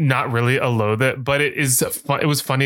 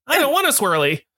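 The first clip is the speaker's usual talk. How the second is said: much higher hammed-up child's whine; mock whiny kid; 14 semitones higher than usual. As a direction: whine like a bullied kid in a studio. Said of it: "And she's like".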